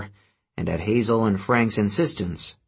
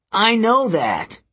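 put-on higher, slow, annoyed teen boy; irritated mechanic; 11 semitones higher, 4 dB louder, slower than usual. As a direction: grumble it at her.

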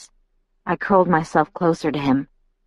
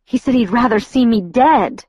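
higher, upset, cracking on the last word; voice breaking; 6 semitones higher, 4 dB louder than usual. A narrator's reading. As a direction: let the hurt rise toward the final word. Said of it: "My voice broke on the last word".

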